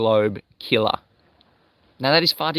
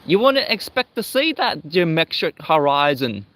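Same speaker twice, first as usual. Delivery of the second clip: mock New Zealand accent, pitched higher; 5 semitones higher than usual. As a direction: imitate him with an exaggerated New Zealand accent. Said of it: "in his New Zealand accent".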